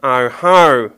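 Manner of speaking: loud knowing butcher; knowing Billy. Acted. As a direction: catch him out. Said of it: "Billy nodded to himself".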